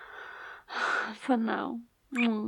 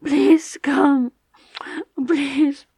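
high, loud, tearful begging; ecstatic pleading; 6 semitones higher, 8 dB louder than usual. Acted. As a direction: cry out in ecstasy. Loud pleading climax talk.

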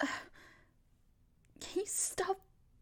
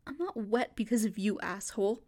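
ordinary delivery

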